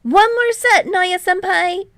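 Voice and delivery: high, loud call across the court. A narrator's reading. Hinata calling to Nishinoya on court, loud high call. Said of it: "Hinata calls".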